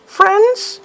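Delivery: very high, sing-out greeting; mock-friendly high hello; timid calling out. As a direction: call into the dark in a high little voice. Calls out to unseen monsters.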